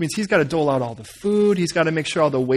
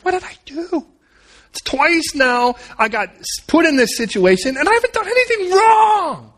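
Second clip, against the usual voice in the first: high-pitched, hammed-up complaint; aggrieved wail; 9 semitones higher than usual. Joseph lamenting in the jail underneath, aggrieved wail. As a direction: build from disbelief to a high wail of injustice.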